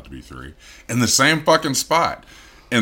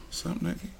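barely voiced mock-tough greeting; very soft, deadpan kid impersonation; imagined kid's reply; 7 semitones higher, 15 dB quieter than usual. Kids answering the friendly adult, softly imitated tough reply. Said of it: "the kids were like".

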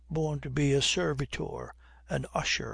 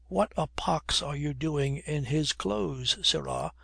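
ordinary delivery

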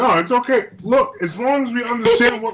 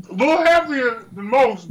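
usual speaking voice

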